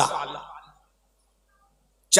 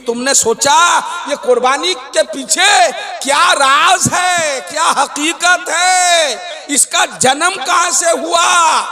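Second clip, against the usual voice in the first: preaching; 7 semitones higher than usual. Acted. Preacher asking listeners about sacrifice's origin, mounting impassioned preaching. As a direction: challenge the congregation with mounting, ringing questions.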